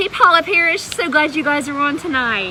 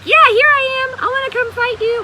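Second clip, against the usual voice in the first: eager volunteer; 13 semitones higher, 7 dB louder, faster than usual. Retold or imitated. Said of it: "Do you think people were like".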